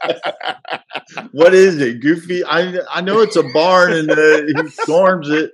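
high, loud laughing words. Real, not acted. Laughing at his own mistake.

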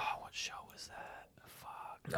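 hushed whisper. Real, not acted. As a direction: whisper so the movie plays on. Whispering aside during movie.

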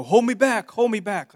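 high, loud plea to be held back; mock would-be fighter. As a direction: imitate a kid putting on a show of fight. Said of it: "the kids that are like".

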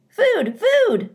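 very high, loud, insistent peeps; squeaky hatchling cries; tiny gosling voice. Acted. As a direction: cheep it like a hungry baby bird. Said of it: "That tiny voice was more than".

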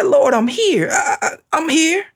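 high, eager answer to God; a servant answering quickly, ready; eager Ananias. Acted. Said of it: "He said".

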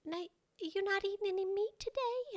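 put-on high, sweet Southern old lady; sweet little Southern voice. Older lady at party asking the narrator, sweet Southern voice. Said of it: "she said in this sweet little Southern voice".

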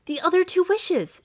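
high, loud urgent wife; rapid, eager reply. A narrator's reading. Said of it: "she replied rapidly".

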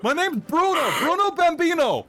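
high, loud bro voice, laughing; a loud fan defending himself; bro heckler voice. Acted. GM holds heckler voice.

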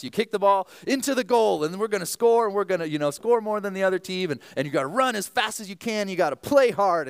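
high, loud hyped-up coach pep talk; rally the little kids like a coach; dad's pregame speech to preschoolers, mock fired up; firing up the team. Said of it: "pregame speech of his life".

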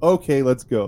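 acted dismissive dad; mock cutting-off. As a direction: playfully talk straight over the refusal. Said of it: "automatically cutting her off".

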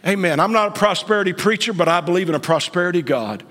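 ordinary delivery

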